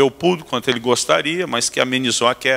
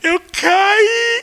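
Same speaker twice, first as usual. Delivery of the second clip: put-on much higher, whiny child voice; crying little boy; 4 dB louder than usual. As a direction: whimper it like a small boy. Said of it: "Aí ele chorando". (voz de criança chorosa e aguda)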